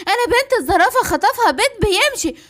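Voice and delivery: ordinary delivery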